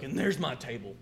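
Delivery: high, loud hammed-up complaint; mimicked pissed-off customer. Hungry customer shows manager the empty table, mimicked angry complaint. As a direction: imitate an outraged diner, raising the voice. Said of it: "he's pissed off".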